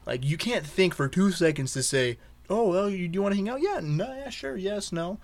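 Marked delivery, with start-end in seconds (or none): 2.5-5.2 high, sing-song mockery; mock someone's clueless replies. Mocking how people text back.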